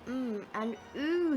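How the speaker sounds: ordinary talk, nothing marked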